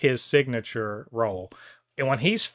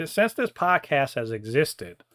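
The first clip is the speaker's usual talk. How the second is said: usual speaking voice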